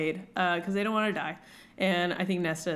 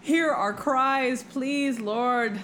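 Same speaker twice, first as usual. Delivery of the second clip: pleading, slow, raised like a prayer; mock prayer; 4 semitones higher, slower than usual.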